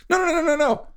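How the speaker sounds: very high quick string of no; eager protest. Acted. Thief correcting the judge, very high and eager. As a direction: wave the judge's mistake away.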